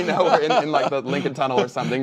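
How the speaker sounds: chuckling through the words; laughing. Real, not acted. Laughing while recounting driving through the tunnel.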